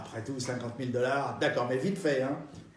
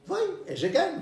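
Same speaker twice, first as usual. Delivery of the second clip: put-on old lady voice; 12 semitones higher than usual. Old lady about bets.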